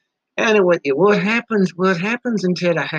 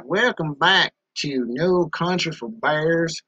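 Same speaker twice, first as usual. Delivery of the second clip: ordinary talk, nothing marked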